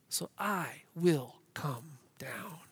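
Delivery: slow, low, whispery reading; hushed promise. Acted. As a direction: let each word fall softly and slowly. Reads God's promise, hushed and slow.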